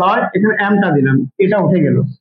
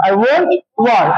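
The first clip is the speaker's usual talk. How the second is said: slow model sentence; much slower than usual. Shown as a demonstration. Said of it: "তখন কি হবে?".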